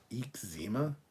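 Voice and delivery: "Eczema" as a rising "eek-ZEE-ma?"; local pronunciation. Shown as a demonstration. Streamer doubts the local version, local pronunciation. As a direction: question "Eczema" with long ee and stressed ZEE. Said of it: "we say".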